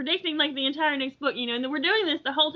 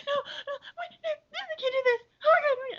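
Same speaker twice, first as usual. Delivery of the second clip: mock panic; 8 semitones higher than usual. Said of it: "I was like".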